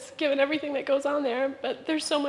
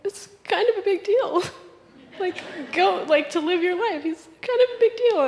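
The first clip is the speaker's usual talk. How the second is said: higher and louder, laughing through the words; laughing quote; 5 semitones higher, 5 dB louder than usual. Retold or imitated. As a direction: lovingly insist it matters, giggling. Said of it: "looking over at me slowly and saying".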